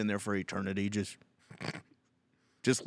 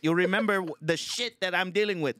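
put-on high-pitched, indignant Filipino accent; livid Filipino tenant; 7 semitones higher than usual. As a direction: protest like a fed-up Filipino tenant. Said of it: "Just mad as fuck".

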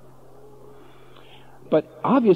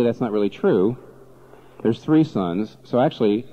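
ordinary talk

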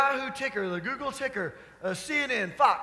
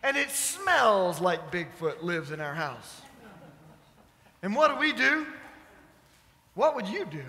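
usual speaking voice

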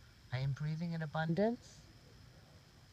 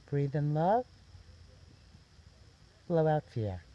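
guided meditation; 3 semitones higher, 9 dB louder than usual. Real, not acted. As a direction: guide them gently, phrase by phrase, lifting the ends before each release.

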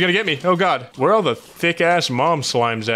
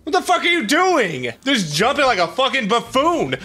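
exasperated yelling; 9 semitones higher than usual. Real, not acted. Player yelling at his own clumsy jumping, exasperated. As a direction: berate someone who keeps messing up.

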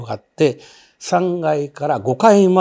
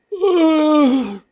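high, drawn-out eerie moan; imitated ghostly groan; 4 dB louder than usual. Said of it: "といううなり声がしたんで".

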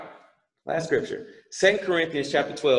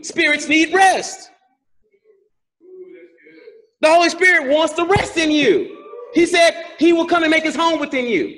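high, driving sermon delivery; emphatic raised-pitch exhortation; forceful preaching; 11 semitones higher, 3 dB louder than usual. Real, not acted. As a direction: drive each point home to the congregation.

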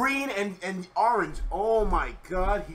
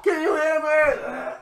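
calling out; 9 semitones higher, 4 dB louder, slower than usual. Real, not acted. Calling out to a collapsed friend.

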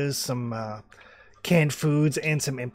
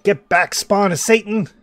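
loud, raised Australian hermit yell; 7 semitones higher, 9 dB louder than usual. Acted. Wild hermit driving intruders away, yelling in an Australian accent. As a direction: yell it down from the hillside. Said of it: "Oh, yeah, he's yelling".

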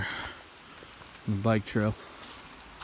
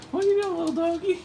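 even higher cooing at the dog; talking to a dog; faster than usual. Real, not acted. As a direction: squeal it to the puppy. Repeated baby talk, higher.

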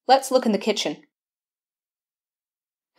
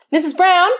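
higher, louder call across the room; 8 semitones higher, 4 dB louder than usual. Acted. Sergeant calls Missus Brown back in, loud and sharp. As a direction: summon her sharply.

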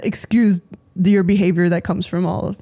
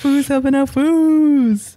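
slow echo; 6 semitones higher, much slower than usual. Acted. Echoing with emphasis.

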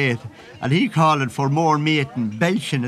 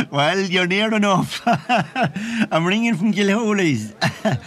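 giggling through the words, a bit higher; laughing; 4 semitones higher than usual. Real, not acted. Caller ringing from the pub at Christmas, laughing.

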